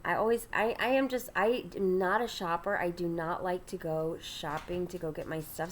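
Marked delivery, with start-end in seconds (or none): none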